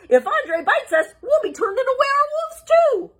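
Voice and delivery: high frantic squeal; panicked Shaggy. Acted. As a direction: screech it in terror.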